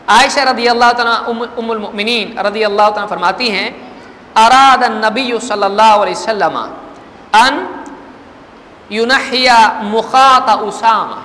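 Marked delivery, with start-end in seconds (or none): 4.3-11.2 slow, louder Arabic hadith reading; hadith recitation. Acted. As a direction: intone the Arabic text deliberately. Reads Arabic hadith text. (حدیث کی آہستہ بلند قرأت)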